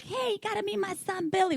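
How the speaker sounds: a chirpy, high imitated mom; imitating his mom. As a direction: play the proud mother pitching her son. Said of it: "she was like".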